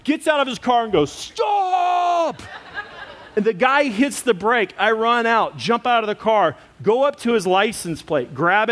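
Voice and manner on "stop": high shouted command; retold shout. Miata Man yelling at the fleeing driver to stop, high shout. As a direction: stop him dead with one yell.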